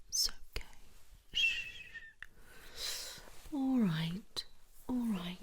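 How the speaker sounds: drawn-out, tender shushing and reassurance; cooing "there, there" comfort; soothing comfort. Acted. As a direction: hush a crying patient gently. Nurse comforts patient crying at news.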